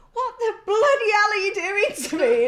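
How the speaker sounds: high, loud, outraged imitation of a bleeding prisoner; mock him yelling in protest; retold angry yell. Bandaged prisoner yells at the officer wrapping his head. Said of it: "he was even swearing at me".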